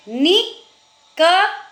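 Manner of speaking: "निकली" in three slow parts, नि, क, ली; syllable by syllable. Shown as a demonstration. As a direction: spell out "निकली" by sound with pauses. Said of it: "अगर हमारा उच्चारण सही होगा".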